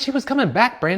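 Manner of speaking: high, loud correction; loud insistence. Retold or imitated. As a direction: push the point hard. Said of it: "I said".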